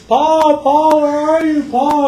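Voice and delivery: high, loud calling out for Pa. Retold or imitated. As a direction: call across the prairie for Pa. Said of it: "they go".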